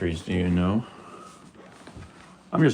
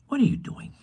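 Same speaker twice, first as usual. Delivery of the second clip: squeaky pet voice; faster than usual. Real, not acted. Baby-talk to the cat.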